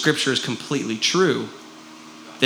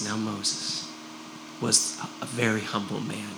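writing it down softly; 5 semitones lower, 11 dB quieter than usual. Retold or imitated. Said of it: "and he writes".

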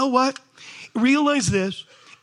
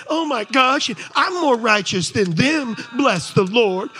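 high, loud self-righteous wail; self-righteous rant; 8 semitones higher, 6 dB louder than usual. Acted. Preacher mocking self-righteous churchgoer, loud high wail. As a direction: wail about how unfair God is.

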